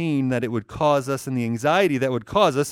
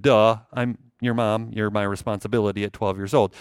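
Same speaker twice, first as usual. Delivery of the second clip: sarcastic duh; 7 semitones lower than usual. Pretend. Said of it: "It's like".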